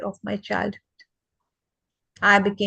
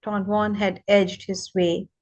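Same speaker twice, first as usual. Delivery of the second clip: ordinary talk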